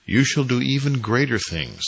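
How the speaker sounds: high, loud, ringing declaration; quoted pronouncement. A narrator's reading. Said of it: "said the Master".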